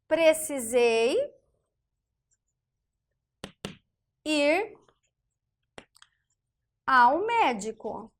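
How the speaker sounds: slow dictation. Shown as a demonstration. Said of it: "alguém respondendo".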